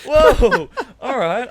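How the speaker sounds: high, loud, laughing through it; laughing whoa. Real, not acted. Laughing while retelling.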